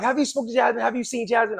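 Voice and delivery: high, strained mother's voice; frantic mother. Retold or imitated. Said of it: "She like".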